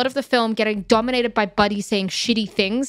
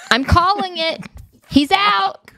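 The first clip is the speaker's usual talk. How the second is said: louder, high, declaring it; high sassy verdict; 10 semitones higher, 4 dB louder, slower than usual. Retold or imitated. Friend at the salon, loud sassy verdict. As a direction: call it like a catty friend.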